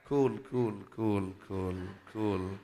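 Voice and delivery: low, slow soothing calm-down; soothing cool down. Retold or imitated. Pastor calming the excited mother, low and slow. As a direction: ease her down, slow and low. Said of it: "నేను అన్నాను".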